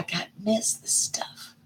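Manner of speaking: quick whispered aside. Real, not acted. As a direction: whisper it to yourself.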